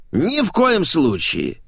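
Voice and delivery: high, drawn-out refusal; firm refusal. A narrator's reading. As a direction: refuse outright in a high, stretched voice. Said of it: "отрезал Илья".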